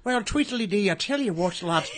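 put-on Irish fighter's brogue; mock Irish accent, trash-talking; Conor McGregor. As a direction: do an Irish fighter addressing press. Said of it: "pretend you're Conor McGregor".

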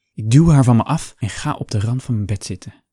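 ordinary delivery

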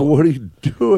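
high-pitched mock outrage; mock indignant yell. Acting out confronting speeding drivers, mock indignant yell. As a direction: call it out like an irate resident.

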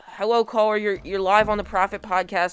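announcer greeting to a caller; call-in host patter; radio host intro. Acted.

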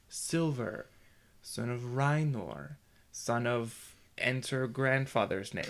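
slow, drawn-out naming chant; measured storyteller cadence. Shown as a demonstration. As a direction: stretch each name out slowly. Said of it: "it speeds up and slows down".

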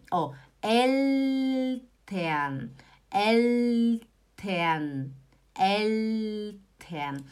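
very slow "Eltern" with a held L; clear L. Shown as a demonstration. Teacher making students notice the L, drawn-out pronunciation. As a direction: stretch the L in "Eltern", tongue up. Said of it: "Vocês estão percebendo que o L".

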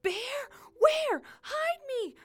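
high, loud yelps; panicked yell back. Acted. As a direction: shriek it like a frightened creature. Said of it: "the thing yelled back".

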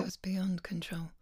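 soft breathy whisper, barely voiced; a hushed, moved repeat; whispered echo. Real, not acted. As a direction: breathe the words back in awe. Hushed echo after painful story.

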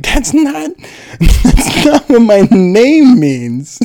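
high, loud, hammed-up childish wail; mock upset kid. Kid reading name's definition online, hammed-up upset wail. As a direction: squeal it in mock distress.

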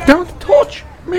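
very high, slow whiny mock; mocking drunk woman. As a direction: squeal it like the drunk passenger.

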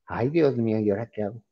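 soft, subdued worry to oneself; worried self-talk. Retold or imitated. Retold panic before going on air. (preocupado, hablando bajito para sí)

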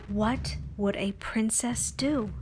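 ordinary delivery

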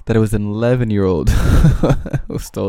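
laughing at the absurd news; amused disbelief. Real, not acted. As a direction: crack up as you tell it. Amused at the tweet he retells.

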